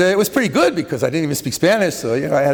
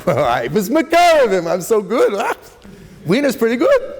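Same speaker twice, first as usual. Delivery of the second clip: higher, hammed-up self-congratulation; mock boasting; 4 semitones higher than usual. Rabbi parodies the self-praising lesson of his story, mock boasting. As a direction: play a man pleased with himself, pitch swelling up.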